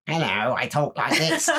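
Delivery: lightly put-on odd voice; a hint of a Skeksis voice; weird voice. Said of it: "has a really weird".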